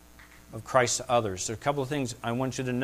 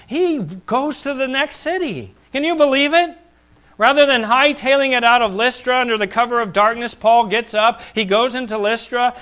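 higher, louder, driving sermon climax; full-force preaching; 8 semitones higher, 8 dB louder than usual. Acted. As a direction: build and hammer it home to the pews.

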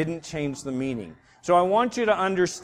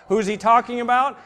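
ordinary talk, nothing marked

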